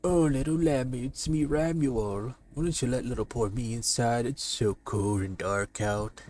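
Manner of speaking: crooning wolf at the door; sly wolf. Acted. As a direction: lure the lamb in sweetly. Wolf voiced in comic reading.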